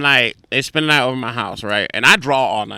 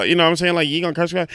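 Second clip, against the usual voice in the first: usual speaking voice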